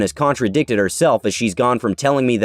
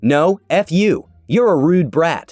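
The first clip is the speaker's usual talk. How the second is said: slow, higher hammed-up insults; mock angry customer; 6 semitones higher, slower than usual. Furious customer insults the narrator, slow mock anger. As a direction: spit it out like the furious lady.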